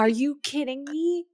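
high, stunned question; shocked disbelief. Real, not acted. Friend hearing the pet was eaten, shocked and high.

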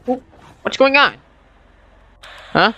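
ordinary talk, nothing marked

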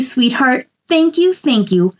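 usual speaking voice

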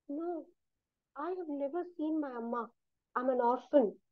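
timid little orphan's reply; small, quiet answer. A narrator's reading. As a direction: answer meekly, barely above a hush. Orphan girl answers shyly.

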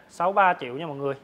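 normal talking voice

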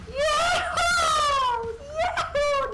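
high sing-song call. Real, not acted. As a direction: call out to find your friend. Calling out to friend in game.

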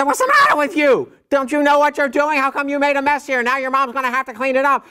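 high, exasperated scolding yell; dad yelling. Retold or imitated. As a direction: scold the kid for the slimy mess. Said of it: "I yelled at him".

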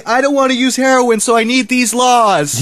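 imitating Ron Paul; quoting Ron Paul. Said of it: "What did he say?".

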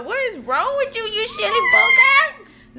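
very high laughing shriek; high laughing shout; laughing mock scold. Real, not acted. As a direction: tease her as you crack up. Teasing daughter's silly noise.

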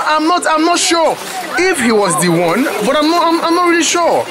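high, rushed, unsure protest; flustered denial. Acted. Man hedging to the market woman, high and flustered. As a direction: back off quickly, flustered.

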